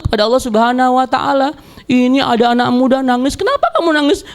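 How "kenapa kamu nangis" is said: asking a crier. Acted. Someone questions a crying youth.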